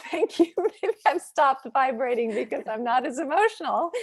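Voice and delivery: a real laugh carried into the remark; amused laughing. Real, not acted. Host jokes with viewers about her vibrating voice, amused laughing.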